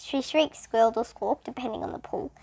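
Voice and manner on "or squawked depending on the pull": ordinary delivery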